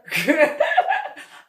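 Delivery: loud high cackle; big amused laugh; real laugh. Real, not acted. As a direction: burst out laughing. Laughing at own story.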